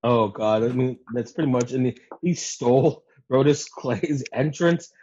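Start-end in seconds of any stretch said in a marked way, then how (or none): none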